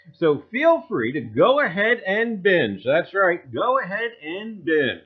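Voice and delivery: loud, bright infomercial hype; mock ad pitch. Host pitches a carb-free episode, hammed-up ad voice. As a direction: sell it like a cheesy diet ad.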